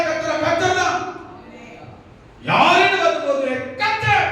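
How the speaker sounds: high-pitched, rousing sermon climax; full-force preaching. Real, not acted. Preacher rallying the church that God never forsakes, full-force preaching. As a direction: preach it high, driving each question to its answer.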